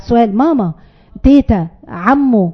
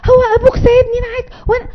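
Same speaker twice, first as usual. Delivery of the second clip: put-on high, tearful begging the child; weak pleading mother; 10 semitones higher than usual. Shown as a demonstration. Mother, beaten by her son, begs him in a high tearful whine. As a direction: crumble and whine helplessly. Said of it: "تخيلوا حد".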